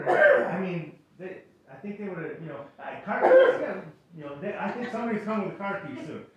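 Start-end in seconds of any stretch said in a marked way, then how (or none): 2.8-3.6 high, loud joking offer through a laugh; hammed-up helpful church member; mock eager offer. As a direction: toss it out as a laughing joke. Joking that they'd offer keys.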